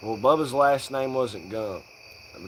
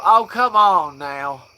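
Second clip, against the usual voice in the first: slow, loud, stretched Southern drawl; 3 semitones higher, 7 dB louder, slower than usual. Retold or imitated. Stoner goofing in a Southern drawl. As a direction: draw it out like a good ol' boy. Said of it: "Southern as possible".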